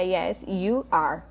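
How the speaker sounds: very slow, deliberate "Yes, you are"; slow model answer. Shown as a demonstration. Teacher giving the answer everyone should record, very slow. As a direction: give the answer slowly like a dictation. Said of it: "a resposta vai ser igual pra todo mundo".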